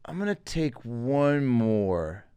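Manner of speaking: put-on slow, soft little-kid voice; imitating a kid.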